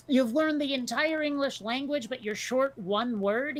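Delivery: normal talking voice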